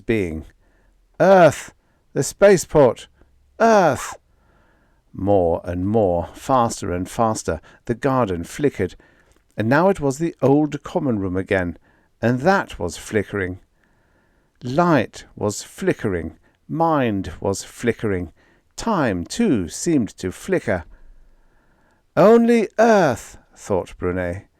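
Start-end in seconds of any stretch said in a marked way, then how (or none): 1.2-4.2 loud desperate cry; straining shout. A narrator's reading. Brunet straining to focus. 22.2-23.4 slow high strained voice; intense focus. A narrator's reading. Brunet's intense thought.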